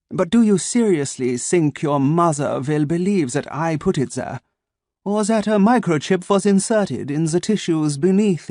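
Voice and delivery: put-on cool German-accented menace; German-accented android threatening the boy. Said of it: "spiced with a German accent".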